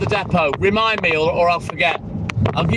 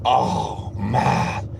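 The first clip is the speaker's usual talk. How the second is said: awed groan; 10 semitones lower, 4 dB quieter than usual. Acted. Inventor's customer overwhelmed by taste.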